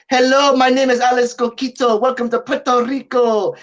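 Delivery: mock the local Puerto Rican English accent. Mocking how locals speak English, Puerto Rican accent. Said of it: "The, the people here will talk like this".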